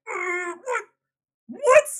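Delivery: high, loud incredulous what; shocked disbelief. Retold or imitated. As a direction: react as if stunned. Initial shocked reaction retold.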